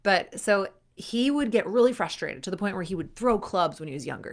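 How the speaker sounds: usual speaking voice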